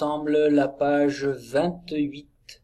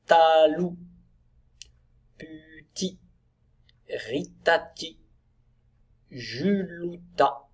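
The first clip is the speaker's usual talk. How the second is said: slow, higher-pitched teacher decoding; syllable-by-syllable reading; 4 semitones higher, slower than usual. Shown as a demonstration. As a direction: sound out each made-up word slowly for children. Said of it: "on va lire les mots inventés".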